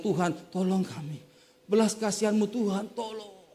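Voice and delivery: softly imitated begging whine; mock whining. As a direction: whine for mercy, fading out softly. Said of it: "merengek-rengek". (menirukan rengekan minta tolong)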